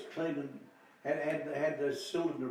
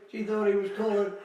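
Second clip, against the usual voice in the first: slow, louder, laughing through the words; can't get it out for laughing; real laughter; 8 semitones higher, 10 dB louder, slower than usual. Real, not acted.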